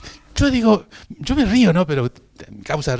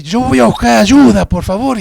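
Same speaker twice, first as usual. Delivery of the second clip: indignant; 8 semitones higher, 9 dB louder than usual. Acted. Indignant at being laughed at.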